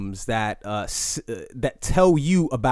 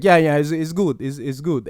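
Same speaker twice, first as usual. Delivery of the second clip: put-on half-hearted African accent, barely shifted from his own voice; a first loose stab at an African accent; sloppy African accent attempt; weak African accent; 3 semitones higher than usual. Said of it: "that is not a African".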